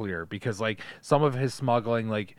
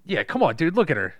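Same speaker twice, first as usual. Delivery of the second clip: hyped bro voice; 8 semitones higher, 8 dB louder than usual. Retold or imitated. Ogling the ship in voice.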